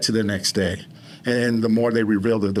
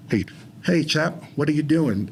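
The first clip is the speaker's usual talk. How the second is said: higher-pitched British chap greeting; British accent; 4 semitones higher than usual. Shown as a demonstration. Man showing how he talks better as a Brit, British accent. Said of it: "like, a British accent".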